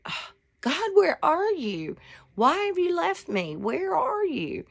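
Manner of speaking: high, wailing appeal, mock-desperate; acted plea to God. Praying to a God who seems gone, pleading. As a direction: cry out to God, pitch swooping up.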